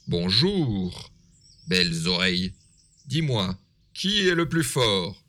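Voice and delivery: loud, boastful wolf. A narrator's reading. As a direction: bold big bad wolf. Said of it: "demande le loup".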